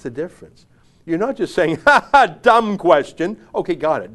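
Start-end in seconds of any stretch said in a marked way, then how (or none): 1.9-3.4 loud, high, drawn-out taunt; mock jeering. Host imitating someone ridiculing a reporter's question, jeering. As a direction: jeer it with a put-on laugh.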